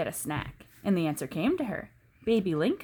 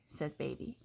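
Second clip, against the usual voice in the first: ordinary delivery, nothing marked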